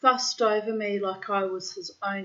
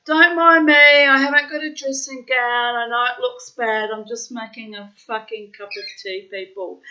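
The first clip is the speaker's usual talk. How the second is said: half-laughing; 5 semitones higher, 11 dB louder than usual. Retold or imitated. Quoting herself to hospital visitors.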